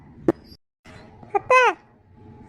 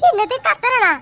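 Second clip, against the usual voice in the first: indignant; 3 semitones higher than usual. Acted. Wife retorts to husband.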